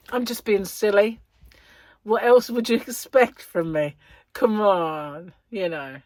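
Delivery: ordinary talk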